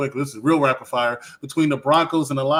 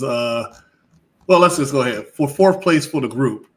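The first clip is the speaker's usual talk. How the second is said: usual speaking voice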